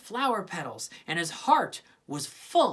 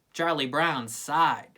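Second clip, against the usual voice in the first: plain reading voice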